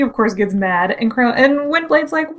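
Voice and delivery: normal talking voice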